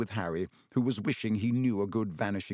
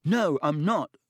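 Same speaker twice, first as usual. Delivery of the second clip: sharp, louder and higher denial; Harry angrily denying Malfoy's taunt; angry; 7 semitones higher, 6 dB louder than usual. A narrator's reading. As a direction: snap back in anger. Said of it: "said Harry angrily".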